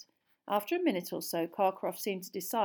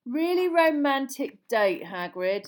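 slow, dry, louder teasing; 7 semitones higher, 8 dB louder, slower than usual. A narrator's reading. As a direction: tease him with mock disbelief. Charlie teasing, shaking his head.

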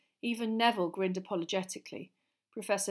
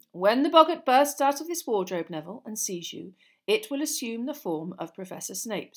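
lively, louder classroom lecture; lightly voiced teacher; 3 semitones higher, 9 dB louder than usual. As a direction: project like an encouraging teacher. Voices teacher coaching pupil.

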